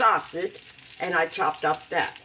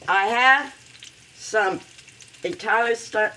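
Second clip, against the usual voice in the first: normal talking voice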